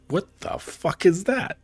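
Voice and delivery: high-pitched, drawn-out mock disbelief; shocked. Ogre bitten on the tail for the first time, mock shocked. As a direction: stretch it out high, acting stunned. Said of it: "was shocked".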